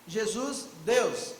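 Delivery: ordinary delivery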